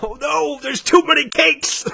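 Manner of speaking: high-pitched mock wail of woe; mock dismay. Co-host teasing the complaint about too many games, mock dismay. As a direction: wail it in comic, put-on despair.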